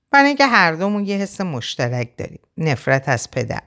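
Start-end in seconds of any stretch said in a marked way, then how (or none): none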